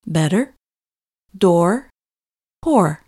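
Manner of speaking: "better", "door", "poor" each with a clear American R at the end; clear final R. Shown as a demonstration. Teacher modelling "better", "door", "poor" for learners, with careful R. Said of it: "Try making the R sound in these three words".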